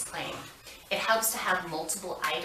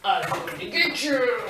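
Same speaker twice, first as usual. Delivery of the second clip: playful monster growl; 6 semitones higher, 5 dB louder than usual. Pretend. Playing dragon chasing child.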